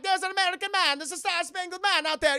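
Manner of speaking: high, loud alarmed soldier calling out. Retold or imitated. Soldiers warning each other that Captain America is here, high panicked shout.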